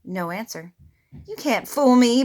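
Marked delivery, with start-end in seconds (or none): 1.3-2.2 louder, unsure growl; mock growl. A narrator's reading. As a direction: growl it without conviction. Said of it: "Bear growled".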